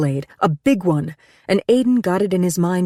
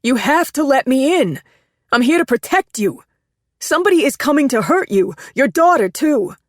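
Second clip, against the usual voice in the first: raised, high urgent warning; frantic pleading; 9 semitones higher, 4 dB louder than usual. Acted. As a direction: shout the warning through the glass.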